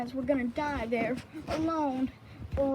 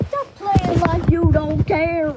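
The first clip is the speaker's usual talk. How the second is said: loud, higher hammed-up scolding; mock exasperated shout; 5 semitones higher, 8 dB louder than usual. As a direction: yell at her to stop faking. Mock plea, scolding Miss Bear.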